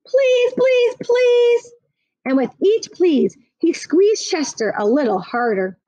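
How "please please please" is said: high, pleading pleases rising in urgency; child squeezing the cat as he begs; begging child. A narrator's reading. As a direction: whine each please harder.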